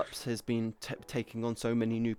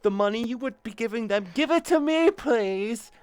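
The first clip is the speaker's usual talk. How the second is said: Cinder impression; 6 semitones higher, 7 dB louder than usual. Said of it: "That's Cinder".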